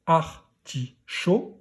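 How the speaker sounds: artichaut in three slow beats, "ar", "ti", "chaut"; artichaut, slowly syllabified for a learner; syllable by syllable. Shown as a demonstration. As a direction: break artichaut apart with pauses. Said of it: "à la troisième syllabe".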